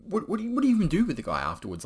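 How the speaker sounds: high and rushed, tumbling out; fast, high disbelief; rapid incredulous rant. Real, not acted. Teller asks the audience in disbelief, rapid high rant.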